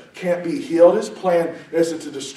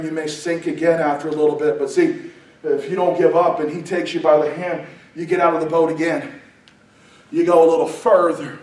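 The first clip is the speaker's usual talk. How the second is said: ordinary talk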